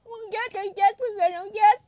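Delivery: high, loud sobbing lament; real crying. Real, not acted. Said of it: "khóc luôn kìa quý vị".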